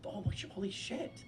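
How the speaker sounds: high, soft, breathless disbelief; quiet, high stunned holy shit; hushed amazement. Retold or imitated. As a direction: gasp it under your breath. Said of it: "And I was like".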